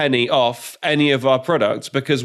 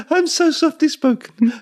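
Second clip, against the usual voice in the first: high podcast voice; 14 semitones higher than usual. Retold or imitated. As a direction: mimic a dainty podcast host. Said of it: "she goes".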